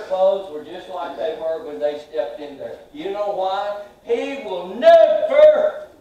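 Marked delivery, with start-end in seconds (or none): 4.0-5.9 never drawn out slow and loud; slow, loud preaching. Acted. Preacher promising the congregation, slow and loud. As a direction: stretch out never for them.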